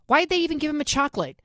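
usual speaking voice

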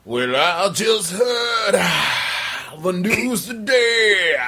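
slow, high, belted rock singer. Retold or imitated. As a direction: croon it like a post-grunge frontman. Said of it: "your [name] impression".

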